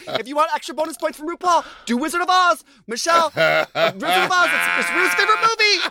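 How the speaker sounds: Michelle Visage impression. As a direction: call it out like a fussy judge. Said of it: "Michelle Visage is always be like".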